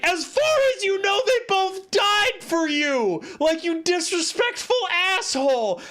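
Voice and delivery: high-pitched, exasperated yelling; outraged rant. Acted. Streamer tells off a fictional teenager, indignant and high. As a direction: scold the character, drawing out the key words. Ranting back at the character.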